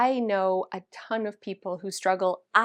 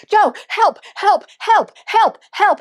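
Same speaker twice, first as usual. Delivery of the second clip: loud, high panicked cries for help; 10 semitones higher, 12 dB louder, slower than usual. Retold or imitated. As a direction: yell for help in short frantic bursts. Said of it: "I'm panicking".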